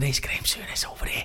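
hushed, excited whisper to a friend; starstruck whisper. Retold or imitated. Whispering to Anna that Graham Souness is here. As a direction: whisper it so he can't hear.

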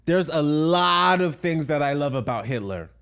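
loud, high, emphatic interview outburst. Retold or imitated. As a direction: declare it loudly and high, stretched out. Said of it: "at a radio interview and he's like".

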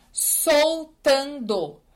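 drawn-out, pointed Soltando; slow emphatic answer. Real, not acted. Answering a follower, Soltando drawn out slowly. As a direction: stretch out Soltando, every syllable weighed.

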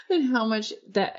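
whispered giggle through the words; nervous laughter. Real, not acted. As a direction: laughs breathily while reading. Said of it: "Why am I laughing?".

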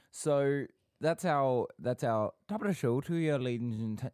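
talking through a mouthful of ice cream; mouth full. Real, not acted. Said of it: "I took a big mouthful of ice cream".

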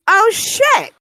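high, loud retold exclamation; startled realization. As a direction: replay the moment it clicked. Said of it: "I was like".

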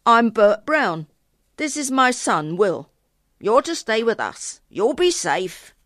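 loud, hearty countryman introducing himself; cart driver. A narrator's reading. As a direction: speak up as a farmer greeting a child. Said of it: "the driver said".

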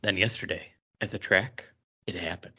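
low, hushed sultry reading; Penthouse letter read. Acted. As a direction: read it like a steamy letter page. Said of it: "read it like it was a Penthouse letter".

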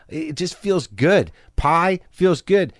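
slow, relishing drawl; savoring. Real, not acted. Praising food he loves, slow and relishing. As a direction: linger over every word.

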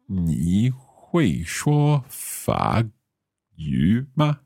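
slow 你会说法语吗, syllable by syllable; careful learner's Mandarin. Shown as a demonstration. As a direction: ask Crystal slowly in Mandarin.

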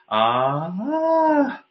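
"ah" sliding up high and thin; losing vocal contact. Shown as a demonstration. Voice coach showing a lost contact, thin rising "ah". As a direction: let the vowel float up and go airy. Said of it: "if I was compromising on the contact".

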